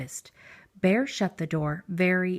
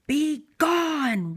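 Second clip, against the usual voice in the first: higher, louder roar; 7 semitones higher, 4 dB louder than usual. A narrator's reading. Bear roaring at the mouse, louder and higher. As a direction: roar him out. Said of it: "roared the bear".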